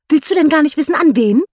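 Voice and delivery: höher und lauter, ungeduldig; impatient call. A narrator's reading. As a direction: ungeduldig auf Antwort drängen. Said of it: "rief seine Frau ungeduldig". (ungeduldige Ehefrau ruft ihren Mann, höher und lauter)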